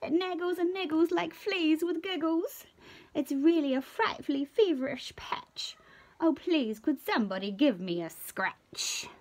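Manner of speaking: high, pleading itchy voice. Acted. Itchy creature begging everyone for a scratch, pleading. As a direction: beg for a scratch, fidgeting.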